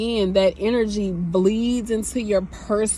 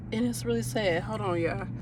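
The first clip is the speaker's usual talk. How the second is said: choked up, fading; 8 dB quieter than usual. Real, not acted. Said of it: "had to get myself together".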